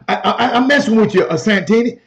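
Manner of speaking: normal talking voice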